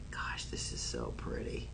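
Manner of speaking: breathy awed whisper to herself. Real, not acted. Host whispering to viewers over a necklace. Admiring necklace on live stream.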